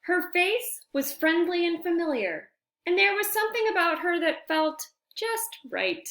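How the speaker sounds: whispered narration. Acted.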